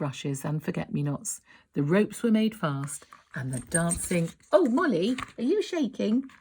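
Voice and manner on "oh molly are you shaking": higher, tender question; gentle concern. Real, not acted. Parent asking the child if she's shaking, concerned. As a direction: check on the little one. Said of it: "aside to child".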